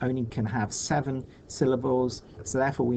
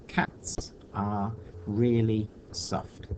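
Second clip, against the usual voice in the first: syllable by syllable; 2 semitones lower, much slower than usual. Shown as a demonstration. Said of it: "how many syllables are there".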